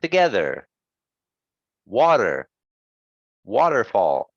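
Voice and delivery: "Together", "Water", "Waterfall", each er weak and short; unstressed er. Shown as a demonstration. Teacher leading repeat-after-me, "Together", "Water", "Waterfall" with unstressed er.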